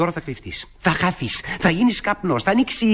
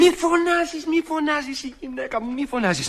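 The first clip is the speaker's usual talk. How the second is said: panicked hushing; 9 semitones higher, slower than usual. Acted. Master fears his wife will hear the girl, panicked hushing. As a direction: beg for silence in a fright.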